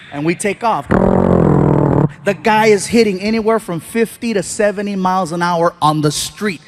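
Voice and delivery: ordinary delivery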